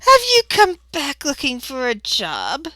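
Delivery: put-on high-pitched old lady question; old woman asking. Old receptionist asking Tonka about work, high-voiced. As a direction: start high, glide down sweetly.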